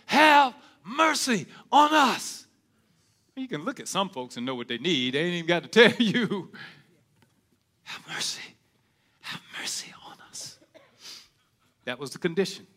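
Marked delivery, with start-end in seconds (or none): 0.1-2.5 slow, loud, high shout of "Have mercy on us"; loud plea. Retold or imitated. Lepers crying out for mercy. 7.8-10.6 soft, breathless whisper of "Have mercy on us"; whispered plea. Retold or imitated. Need shown without words.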